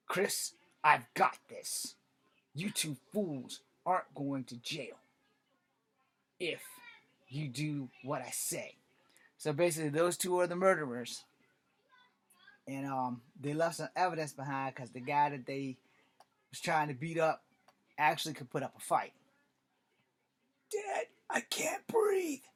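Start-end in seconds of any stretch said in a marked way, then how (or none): 0.1-8.8 menacing whisper. Acted. Father threatens the boys. 20.7-22.4 choked whisper. Acted. Boy being choked.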